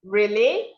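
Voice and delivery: "Really" with the voice going high at the end; expressive, rising "Really?"; rising intonation. Shown as a demonstration. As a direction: lift the end of "Really" into a surprised question. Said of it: "your voice goes high".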